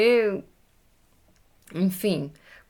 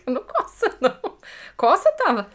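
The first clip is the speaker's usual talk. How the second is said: laughing; 3 semitones higher than usual. Real, not acted. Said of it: "laughing at partner's remark".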